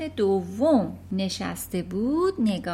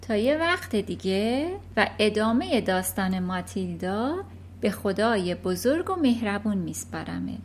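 plain reading voice

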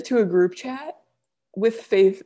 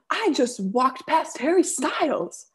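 high, slow, stretched-out amazement; imitated awestruck girl; 6 semitones higher, slower than usual. Storyteller voicing girl on the street, awestruck and high. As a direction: act out a stunned fan's announcement. Passing girl's amazement acted out.

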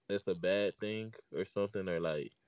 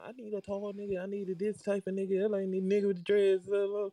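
much higher mock girly voice listing demands; mocking women; 11 semitones higher, 3 dB louder than usual. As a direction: imitate a picky woman, high and whiny.

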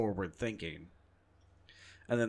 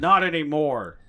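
louder, clipped Ford voice; Harrison Ford impression; 4 semitones higher, 9 dB louder than usual. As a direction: bite off the line like Ford. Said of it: "That's the Harrison Ford impression".

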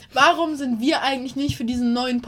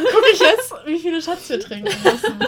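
laughing; 6 semitones higher, 11 dB louder than usual. Real, not acted. Laughing while checking shots.

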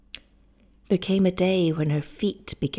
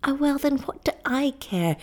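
higher-pitched exclamation without extra volume; crying out; 7 semitones higher than usual. A narrator's reading. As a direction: read it as a character's impassioned cry. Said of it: "she cried".

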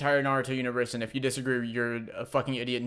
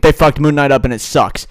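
shouting; 14 dB louder than usual. Real, not acted. Hot take shouted.

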